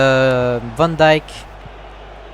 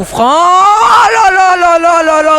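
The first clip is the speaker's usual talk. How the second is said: excited sports commentary; 13 semitones higher, 8 dB louder, slower than usual. Acted. Free kick goal celebrated.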